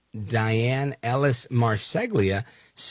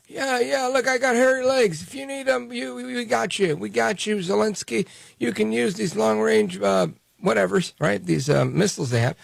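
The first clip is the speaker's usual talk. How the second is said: Biden mocked in a voice pitched far higher; 10 semitones higher than usual. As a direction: lampoon the president with a squeaky, much higher voice. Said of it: "So Biden says".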